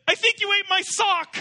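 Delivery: high, loud, panicked blurt; punchline, frantic husband. Retold or imitated. Husband accusing his wife at the punchline, high panicked shout. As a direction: deliver the punchline in a frantic squeal. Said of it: "He said".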